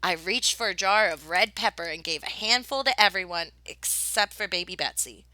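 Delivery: plain reading voice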